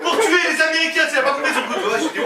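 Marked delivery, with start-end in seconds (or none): 0.0-1.1 high, insistent mock-tourist delivery; phrase mal comprise. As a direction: spell it out high, word by word. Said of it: "il a cru que je disais". (imiter le touriste qui insiste, voix aiguë)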